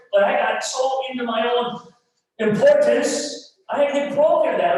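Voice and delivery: high, loud, impassioned testimony; full-force preaching. Acted. Pastor confessing his own pride to the church, preaching at full force.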